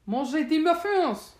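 loud, high battle cry; shouting. A narrator's reading. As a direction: bellow it as a French war cry.

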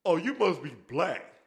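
slow, drawn-out mocking voice; a slow, sneering put-on voice. Imitating the gamer who taunted him. Racist gamer quoted over voice chat.

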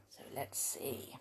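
whispered aside to herself. Real, not acted. Reader muttering to herself while finding the page, whispered. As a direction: drop to a breathy whisper, thinking aloud.

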